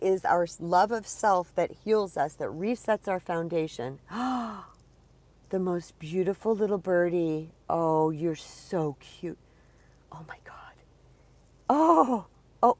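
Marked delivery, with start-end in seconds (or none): 4.1-4.6 delighted gasp. Real, not acted. Spots a bird outside. 5.5-9.4 sweet doting coo at a cute birdie. Real, not acted. Cooing at a bird. 10.1-10.7 breathless whisper of delight; hushed amazement; awed whisper. Real, not acted. Awed at bird. 11.7-12.8 high loud delighted cry; thrilled high cry at the bird; excited squeal. Real, not acted.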